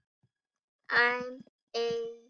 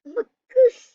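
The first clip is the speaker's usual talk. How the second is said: much higher, much louder shriek; shocked shriek; 11 semitones higher, 10 dB louder than usual. Real, not acted. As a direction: scream it out in shock. Reacting to reveal.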